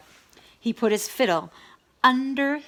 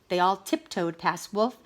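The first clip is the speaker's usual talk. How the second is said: ordinary delivery, nothing marked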